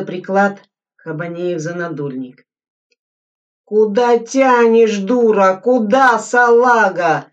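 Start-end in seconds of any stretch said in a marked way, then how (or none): 3.6-7.3 louder, slow strained rasp; wheezing. A narrator's reading. Soldier fighting over the rifle, strained rasping. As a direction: tug and curse through strain. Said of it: "хрипел Сайко".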